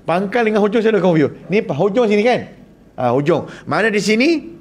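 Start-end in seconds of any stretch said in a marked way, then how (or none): none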